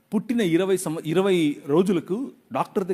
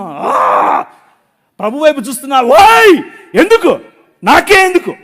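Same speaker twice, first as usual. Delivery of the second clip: high, loud cry of why me; anguished shout; 8 semitones higher, 10 dB louder than usual. Retold or imitated. Father by the roadside shouting to God, high and loud. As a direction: yell up at the sky in despair. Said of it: "గట్టి గట్టిగా అరుస్తున్నా".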